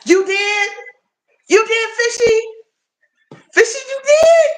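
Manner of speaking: very high squealed questions, building; thrilled disbelief. Real, not acted. Streamer hears a buddy got monetized, squealing in surprise.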